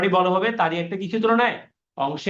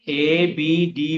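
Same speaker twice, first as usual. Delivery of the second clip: drawn-out answer; much slower than usual. Shown as a demonstration. Teacher drags out new angle name.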